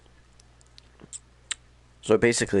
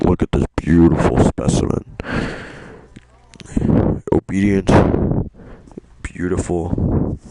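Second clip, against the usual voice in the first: smug villain voice; 2 semitones lower, 7 dB louder than usual. Retold or imitated. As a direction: savour each word like a collector. Kamsky voiced, false start redone.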